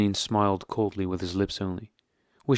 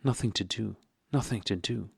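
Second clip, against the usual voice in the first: softer, a mild tearful mutter of Nothing to do; 5 dB quieter than usual. A narrator's reading. Dolly repeats Karenin's words to herself, softly and tearfully. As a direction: repeat it under the breath, close to tears. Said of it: "she muttered with tears in her eyes".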